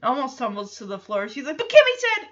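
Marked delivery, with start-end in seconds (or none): 1.6-2.3 high, loud indignant protest; acted-out outraged little protest; mock outrage. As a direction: burst out in imitated outrage. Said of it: "so outraged".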